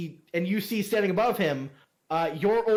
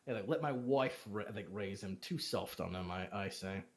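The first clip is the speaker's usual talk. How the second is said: 1 grumbling low; 10 semitones lower, 11 dB quieter than usual. Acted. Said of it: "He goes".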